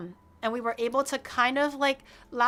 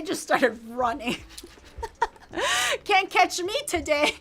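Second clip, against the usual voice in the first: laughing; 4 semitones higher than usual. Real, not acted. Laughing while retelling the escape.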